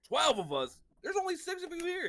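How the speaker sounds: high, loud mock astonishment; acted incredulity. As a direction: echo it back as an outraged objection. Acts listener's incredulous echo of claim.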